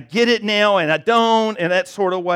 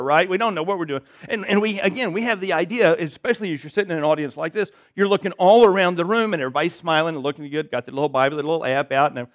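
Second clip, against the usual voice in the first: ordinary talk, nothing marked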